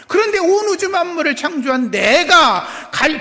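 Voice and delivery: high, loud proclaiming voice; Jesus declaring himself God to the disciples, loud; bold declaration. Acted. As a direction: proclaim who you are with power. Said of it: "예수님 말씀은 그거예요".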